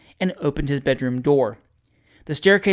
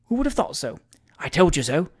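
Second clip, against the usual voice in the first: ordinary delivery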